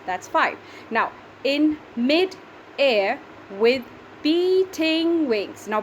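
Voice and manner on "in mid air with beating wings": "In mid-air with beating wings" in very slow separate beats; syllable by syllable. Shown as a demonstration. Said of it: "so that makes it seven".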